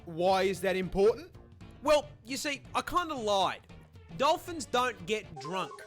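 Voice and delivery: ordinary delivery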